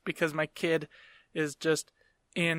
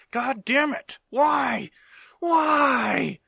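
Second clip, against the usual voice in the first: high, loud cry of despair at the sky; exasperated shout; 8 semitones higher, 5 dB louder, much slower than usual. Retold or imitated. Dad at wit's end over son's soiled pants, shouting high. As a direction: yell it up to the heavens, fed up.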